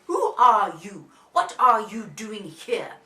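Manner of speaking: loud, slow, booming demand; very cross. A narrator's reading. Three bears confront Goldilocks, loud and cross. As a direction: hammer out both questions angrily. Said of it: "Baby are all cross".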